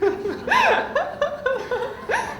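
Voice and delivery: sudden high peal of laughter; amused high laugh. Real, not acted. Laughs at never returning.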